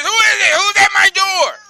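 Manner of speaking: high, loud shrieking witch. Acted. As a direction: shriek like an angry witch at her door. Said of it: "The witch says".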